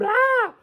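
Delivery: high mock scream of shock; hammed-up scream. The storyteller voicing everyone screaming at once, in a high mock scream. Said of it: "Everybody screamed".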